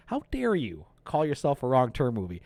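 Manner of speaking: ordinary talk, nothing marked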